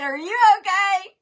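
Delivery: high, loud imitation of a worried caller; hammed-up concern; mock alarmed question. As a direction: retell his alarm with amusement. Retold alarmed question during choking.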